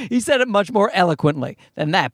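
usual speaking voice

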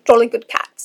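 loud, mock British child accent; child's British accent. Niece trying to say the phrase, loud child's British accent. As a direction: imitate a small child's British accent, loudly. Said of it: "as a child with a British accent".

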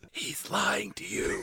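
slow whispered aside; hushed warning to the audience. Real, not acted. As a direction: lean in and whisper it. Co-host stage-whispers aside.

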